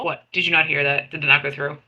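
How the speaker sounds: ordinary talk, nothing marked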